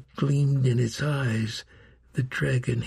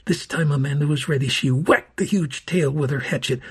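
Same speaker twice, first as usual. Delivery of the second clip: plain reading voice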